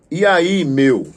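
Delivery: put-on playful greeting between two young guys; casual slang greeting. Shown as a demonstration. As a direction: call out to a buddy on the street. Teacher voicing youth greeting.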